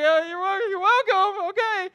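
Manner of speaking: high, loud, comically awkward; flustered reply. Retold or imitated. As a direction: brush off too much thanks while backing away. Flustered reply to overdone thanks.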